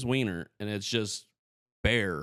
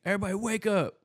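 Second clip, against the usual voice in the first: high, mock holler at normal volume; boss's wake-up call; 10 semitones higher, faster than usual. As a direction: act out calling the room awake. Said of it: "the biggest, scariest guy would be like".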